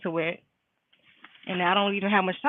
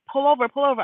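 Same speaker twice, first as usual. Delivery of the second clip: urgent mom; 6 semitones higher, 5 dB louder, faster than usual. Retold or imitated. Retold worried mom orders a stop.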